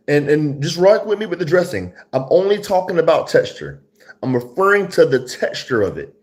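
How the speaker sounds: high, loud, animated explaining; excited insistence. Real, not acted. Reviewer defends his dressing comparison to viewers, excitedly insisting. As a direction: plead your case high and loud.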